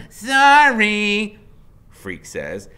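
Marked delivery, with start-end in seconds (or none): 0.1-1.4 much higher, loud nervous sorry; chattery high. Acted. Scared boy refusing the biker, chattery high. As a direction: squeak it out, scared. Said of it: "his voice is chattery high".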